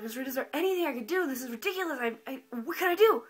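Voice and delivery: hammed-up frantic appeal; desperate plea. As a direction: plead with the teacher, building to a loud finish. Retold desperate plea to teacher.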